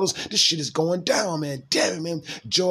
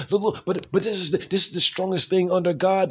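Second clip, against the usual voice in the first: usual speaking voice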